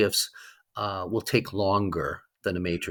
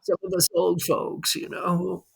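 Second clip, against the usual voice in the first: higher, put-on old-timer voice; old person voice; 8 semitones higher than usual. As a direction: play it like one of the old folks. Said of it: "I should put on my old person voice".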